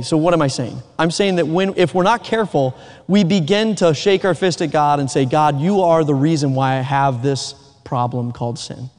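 ordinary talk, nothing marked

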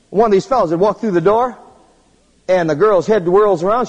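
ordinary talk, nothing marked

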